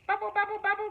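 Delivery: high grumbling bubbling voice; grumbling stew. A narrator's reading. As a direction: grumble it like an impatient pot. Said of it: "grumbled the stew".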